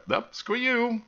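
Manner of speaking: high, loud mock refusal; mock dismissive. Voices Russians refusing Poland.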